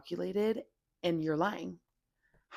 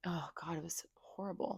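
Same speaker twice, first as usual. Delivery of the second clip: breathy whisper, voice dropping to nothing; whispered dread; 8 dB quieter than usual. Real, not acted. To her viewers, recalling the bad relationship, whispering. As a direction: whisper it like you can barely admit it.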